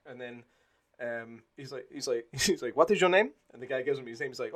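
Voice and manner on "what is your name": a hint of a curt officer, higher and louder; generic Nazi voice. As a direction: bark the question lightly. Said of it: "my generic Nazi voice".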